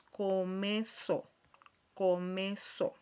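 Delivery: comezo slowly, syllable by syllable, "co-me-so"; slow reading. Shown as a demonstration. Teacher reads so they understand, comezo slow and separated. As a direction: draw out comezo for learners. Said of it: "Se lo leo despacio".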